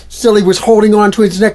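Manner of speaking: fast, loud, laughing telling; excited laughing narration. A narrator's reading. Storyteller swept up in the wild sofa ride. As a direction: rush it out, laughing.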